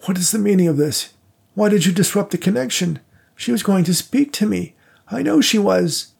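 high, strained anguish at being cut off; angry old man. A narrator's reading. Widower demands why the seance was disrupted, anguished and high. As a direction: demand it, voice climbing in distress. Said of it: "Daniel Worthington angrily cast his eyes".

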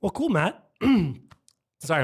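hoarse and losing voice; rough, worn-out voice. Real, not acted. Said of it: "I'm losing my voice here".

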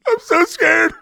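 very high, loud scared squeal; hammed-up panic, way up high; mock terror. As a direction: squeal it out in exaggerated fright. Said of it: "No, now I'm scared".

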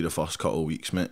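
ordinary talk

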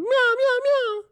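very high imitated meowing; high-pitched kitty meows; cat meow. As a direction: squeak out a little cat cry.

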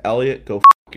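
loud curse; student standing up to the bully; angry shout. Retold or imitated. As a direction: bark it at him. Cursing out the bully.

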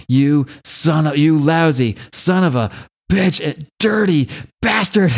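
slow, punched-out curses, rising in pitch; mock angry cursing. Imitating Kevin smacking the ogre, hammed-up rage. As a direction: hammer out each insult between imagined blows.

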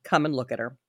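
low, soft invitation; said softly. A narrator's reading. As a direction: drop the voice gently. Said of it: "I said softly to him".

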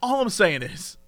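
normal talking voice

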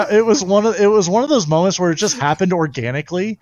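giggling out the words as the memory cracks him up; laughing through a funny story. Real, not acted. Laughing while retelling the story.

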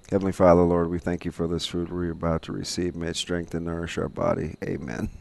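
low, soft, quick recited grace; mom's dinner prayer. Retold or imitated. Co-host recites mom's prayer before dinner, soft and low. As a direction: murmur a familiar family grace, low and hushed. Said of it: "if it was my mom, she would say".

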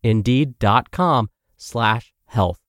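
usual speaking voice

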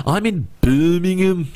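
high, slow, hammed-up Brummie accent; mock Birmingham accent, drawn out and high; bad Birmingham accent. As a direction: put on a terrible Birmingham accent for a laugh. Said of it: "that's the worst Birmingham accent you've ever heard".

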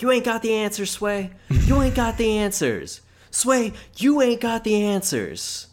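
high, loud mock outburst, stretched out at the end. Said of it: "That's a good [name] impression".

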